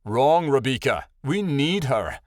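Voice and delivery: firm, slow snapped rebuke; snapping. A narrator's reading. Tattle rebukes Rebecca over their friend, snapping. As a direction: correct her sharply. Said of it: "Tattle snapped".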